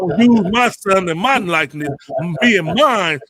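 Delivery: high, loud mock ranting. Host imitating a rapper's rant about his son, loud high mock indignation. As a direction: imitate an indignant, possessive father. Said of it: "T I going around Atlanta talking about".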